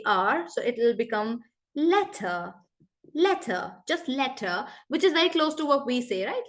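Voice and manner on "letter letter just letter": "letter" in a British accent, crisp T, no R at the end. Shown as a demonstration. Teacher models British letter for the class. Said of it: "they will pronounce this word as letter".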